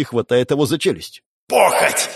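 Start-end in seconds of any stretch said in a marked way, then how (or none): 1.5-2.1 growled demonic word. Acted. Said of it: "прорычал он". (демоническое рычание)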